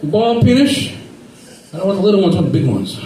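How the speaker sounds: hint of a Georgia Southern voice; Southern customer ordering peanuts, lightly Southern; southern accent. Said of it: "with the southern accent".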